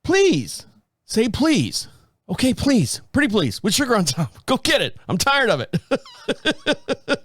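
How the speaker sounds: a mock whiny wife giving in. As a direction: exaggerate her pretty please with sugar on top. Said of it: "I hear her in the background going".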